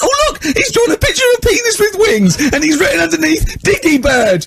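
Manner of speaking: very high, shrieking disgust; berserk teacher. Retold or imitated. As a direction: squeal it out in horrified outrage. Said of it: "he went berserk".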